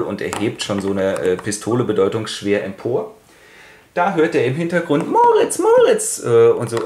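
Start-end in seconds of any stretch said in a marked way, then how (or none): 5.1-6.2 high, drawn-out call across a distance; a long high call to a friend from afar. Retold or imitated. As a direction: sing his name out from far away. Ilse calling him from afar.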